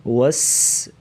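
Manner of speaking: والس- ending on a long, hard whistling s; whistling seen. Shown as a demonstration. Teacher shows the whistle-like letter, holding the s of والس-. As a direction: press and hold the hissed s of والس-. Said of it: "سین، سیٹی جیسی آواز نکالیں گے".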